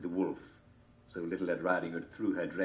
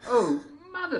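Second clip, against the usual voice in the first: put-on girl voice; 7 dB louder than usual. A narrator's reading.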